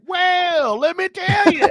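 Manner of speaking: high, loud folksy Southern drawl; Google South voice. Pretend. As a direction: play a chatty Southern search engine. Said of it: "Google comes back and it's like".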